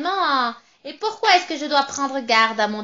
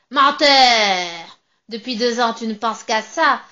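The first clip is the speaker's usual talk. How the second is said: loud, triumphant witch voice with a cackle; gloating witch; 6 dB louder than usual. Acted. Witch gloating over the trapped narrator, loud and cackling. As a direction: crow over your catch, louder and louder.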